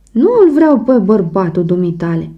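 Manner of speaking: plain reading voice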